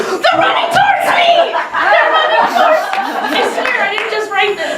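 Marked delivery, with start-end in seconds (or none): 0.0-1.5 panicked. Real, not acted. Panic at the rival team. 1.5-3.0 panicked. Real, not acted. Repeated panic.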